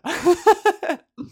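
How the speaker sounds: loud, high cackle at herself; high burst of laughter. Real, not acted. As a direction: laugh out at her own joke. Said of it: "Who knows what'll make me hard?".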